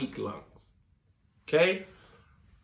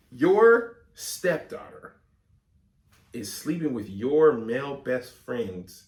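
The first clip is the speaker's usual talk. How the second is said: slow puzzling; slower than usual. Real, not acted. Slowly working it out.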